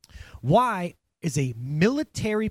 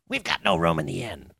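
mean innkeeper; 11 semitones lower than usual. Retold or imitated. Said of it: "this mean innkeeper".

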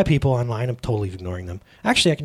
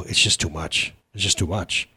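weary lament; 12 semitones lower, 5 dB quieter than usual. Retold or imitated. Retold complaint to wife.

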